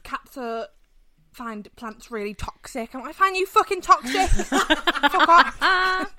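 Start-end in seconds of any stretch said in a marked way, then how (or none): 3.1-6.1 higher and louder, laughing through it; mock snap, laughing. Snapping at the plant-warners with laughter. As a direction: fire back in jest, cracking up.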